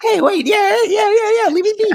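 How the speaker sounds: very high squeaky whine; squeaky whiny voice. Pretend. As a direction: whine like a cornered cartoon. Pleading to be left alone.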